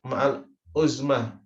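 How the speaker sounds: ma'al uzmah said slowly and deliberately; teacher dictating ma'al uzmah slowly; slow term demo. Shown as a demonstration. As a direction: lay out ma'al uzmah word by word for students. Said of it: "Kemudian yang kelima".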